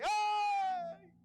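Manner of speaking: sudden shrill yelp of a frog; a sharp "ay!" like a frog startled; high cry. A narrator's reading. Storyteller voicing a frog's cry, high yelp. Scream heard in the forest.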